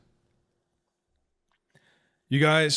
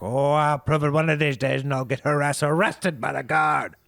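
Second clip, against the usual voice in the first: Herb; 6 semitones higher, 6 dB louder than usual. Acted. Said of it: "He's like".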